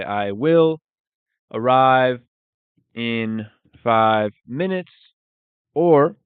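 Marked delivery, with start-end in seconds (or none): 0.0-4.9 each word spelled out slowly, "arrive" said louder; slow it down so learners catch every word; slow, clear teaching repeat. Shown as a demonstration. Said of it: "So we would say".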